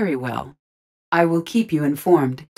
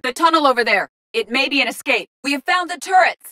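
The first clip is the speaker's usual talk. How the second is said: raised, high-pitched alert; urgent combat call-outs; 8 semitones higher, 3 dB louder than usual. Acted. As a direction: point it out mid-fight. Pointing things out in combat.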